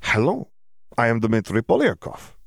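put-on Eastern European accent, hello lifted high like a question; accented, word-by-word self-introduction; foreign student practicing accented English. A narrator's reading. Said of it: "practicing his English or just very drunk".

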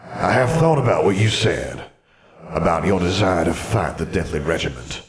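echoing ghostly wizard voice; formal spectre with a hollow reverb; solemn ghost. Acted. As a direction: address a descendant as a grave old phantom. Ghost addresses his descendant.

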